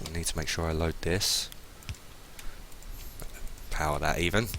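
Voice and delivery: ordinary delivery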